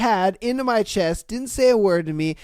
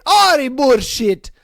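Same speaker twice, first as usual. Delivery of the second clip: loud, high Scottish disbelief; mock Scottish accent; 4 semitones higher, 7 dB louder than usual. Scotsman rejecting the Canadian answer, loud mock Scottish accent. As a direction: scoff loudly in put-on Scottish. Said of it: "Happened in Scotland".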